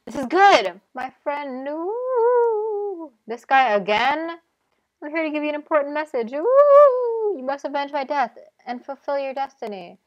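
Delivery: high, wavering ghostly spirit; mystic vision voice. Acted. Spirit in a spice hallucination delivering destiny, high eerie voice. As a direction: swoop up like a ghostly apparition.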